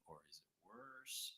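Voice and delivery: very soft, dropping to a whisper; quiet test voice. Shown as a demonstration. Streamer asking viewers while speaking very softly, whispering. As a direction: let the voice fade to test the mic. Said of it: "when I talk quietly".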